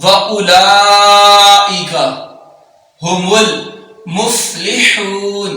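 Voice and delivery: slow, drawn-out tajweed chant; Quran recitation. Acted. Teacher recites the verse slowly for students. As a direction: recite slowly, holding every long vowel.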